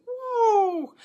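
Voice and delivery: long, loud high "woo"; excited whoop. Real, not acted. Host marvels at the group meditation, excited whoop. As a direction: let out a high, sustained whoop. Exclaims over a powerful meditation.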